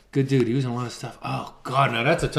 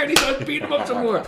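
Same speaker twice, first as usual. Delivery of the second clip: laughing; 11 semitones higher than usual. Real, not acted. Cracking up at own movie story.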